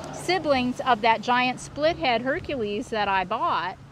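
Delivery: ordinary talk, nothing marked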